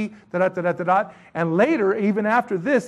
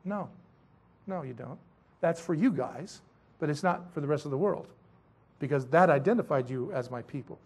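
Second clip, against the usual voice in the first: low, hushed gentle correction; imitated calm authority, much softer and lower; the Lord answering; 6 semitones lower, 7 dB quieter than usual. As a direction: drop low and soft to voice a quiet divine refusal. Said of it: "the Lord is saying".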